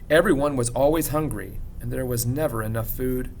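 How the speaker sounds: ordinary delivery, nothing marked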